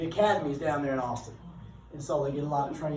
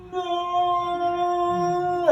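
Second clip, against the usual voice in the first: high, loud, stretched-out wail of dismay; a long singsong cry of dread as the kids run off; teacher's mock horror at the kids stampeding toward the governor; horrified no; 9 dB louder than usual. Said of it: "And I'm like".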